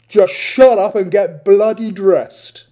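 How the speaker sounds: bellow. Acted. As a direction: roar it across the room. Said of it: "bellowed from the showers".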